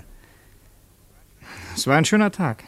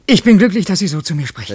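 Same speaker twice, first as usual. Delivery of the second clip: overjoyed; 4 semitones higher, 8 dB louder, faster than usual. Acted. Moved by governor's words.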